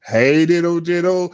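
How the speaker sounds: usual speaking voice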